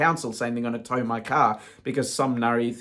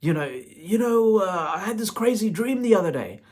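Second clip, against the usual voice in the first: imitating brother; 8 semitones higher, 4 dB louder than usual. Brother on the phone telling his dream, higher put-on voice. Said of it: "That's how he talks".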